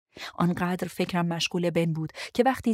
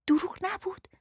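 whispered, high, disbelieving echo of دروغ نبود; incredulous whisper; 13 semitones higher than usual. Acted. Lou whispering back at Ben in disbelief, incredulous whisper.

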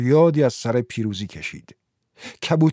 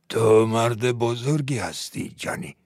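put-on measured, reverent old-chief delivery; solemn admirer; 2 semitones lower than usual. Chief praises Johnny in story.

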